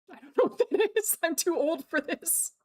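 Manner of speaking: high-pitched laughing bewilderment; baffled laughing. Real, not acted. Streamer feels too old for the slang, laughing. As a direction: laugh helplessly through the words.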